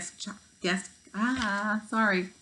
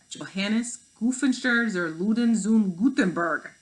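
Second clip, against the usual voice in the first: put-on slow, careful German-accented name, a little louder; 4 semitones higher, 6 dB louder, slower than usual. Hologram Gutenberg introduces himself, German accent. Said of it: "said a man with a German accent".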